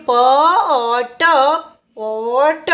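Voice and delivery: પ, ઓ, ટ held apart, then joined as પોટ; sounding out. Shown as a demonstration. Teacher teaching learners to read pot, sounding out. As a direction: sound out each letter, then blend પોટ. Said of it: "પીનું ઉચ્ચારણ થશે".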